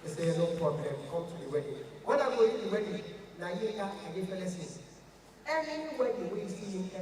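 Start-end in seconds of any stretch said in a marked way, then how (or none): 0.4-1.8 a lightly deep rich big man. Pretend. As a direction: sound like money talking. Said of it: "your voice must deep".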